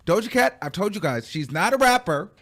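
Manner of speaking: normal talking voice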